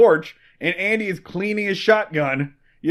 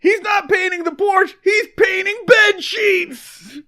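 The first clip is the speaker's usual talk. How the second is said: gleeful taunt; 10 semitones higher than usual. Acted. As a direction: crow the dirty punchline at the top of your pitch. Acted taunting broadcast continues.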